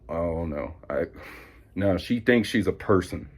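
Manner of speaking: ordinary delivery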